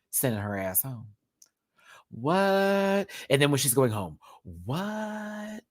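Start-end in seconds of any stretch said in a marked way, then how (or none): 2.1-5.6 higher, fake shocked "What?"; mock surprise. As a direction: feign innocent shock. Acting innocent while eliminating.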